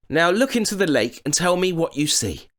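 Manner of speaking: put-on high boyish voice; boy's voice. A narrator's reading. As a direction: sound cheerful and clever as a boy. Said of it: "said Jack".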